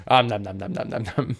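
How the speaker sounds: munching while talking; chewing sounds, mouth full. Real, not acted. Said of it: "distracted by eating sausages".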